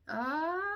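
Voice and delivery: long high scream, mock agony; imitated pain scream. Mother re-enacting screaming in labour, loud high wail. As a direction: let out a drawn-out, high-pitched wail. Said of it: "like, screaming in pain".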